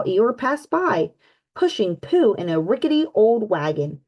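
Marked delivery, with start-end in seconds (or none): none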